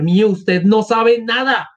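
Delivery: slow protest climbing in pitch; understated acted outcry; mild indignant cry. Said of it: "gritó Katerina Ivanovna".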